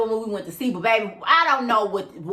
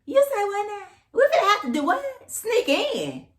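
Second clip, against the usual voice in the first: high-pitched disbelieving questions; incredulous reaction; 11 semitones higher than usual. Retold or imitated. Woman questioning her date's sneaky plan, high and incredulous. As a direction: echo it back in high, mock shock. Acts out her shocked reply.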